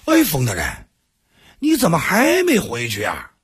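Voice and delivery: mock astonishment at bumping into the envoy; feigned surprise. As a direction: pretend to be amazed to see him, overplaying it. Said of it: "他故作惊讶".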